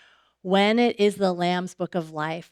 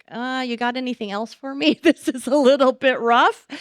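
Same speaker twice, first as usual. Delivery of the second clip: higher, louder, with a laugh, half-joking dismay; mock groan at a tough list; mock dismayed parent; 5 semitones higher, 4 dB louder than usual. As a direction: play the overwhelmed parent, laughing it off. Parent overwhelmed by the plan list.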